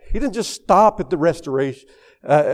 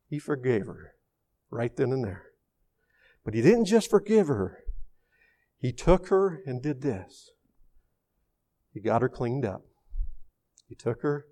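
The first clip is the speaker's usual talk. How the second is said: very soft, lower, with long pauses; hushed tenderness; 3 semitones lower, 10 dB quieter than usual. Real, not acted. Preacher tells how dad forgave her, hushed and tender. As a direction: let it drop to a quiet, reverent storytelling. Hushed telling of father's forgiveness.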